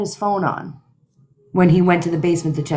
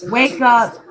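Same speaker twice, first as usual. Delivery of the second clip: higher, louder mock yell; retold yell; 4 semitones higher, 4 dB louder than usual. Man on the 911 call yelling at his child, mock yell. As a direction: read the plea as a raised half-shout. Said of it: "And then there's yelling".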